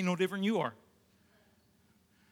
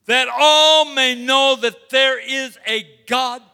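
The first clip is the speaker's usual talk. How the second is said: booming, slow proclamation; loud proclamation; 6 dB louder, slower than usual. Acted. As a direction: proclaim it to the whole army. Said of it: "talking to Goliath".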